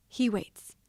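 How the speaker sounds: ordinary delivery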